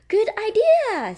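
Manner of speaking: very high, loud little mouse; high loud mouse voice agreeing; Mandy's voice. Acted. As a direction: squeak your approval. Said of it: "says Mandy Mouse".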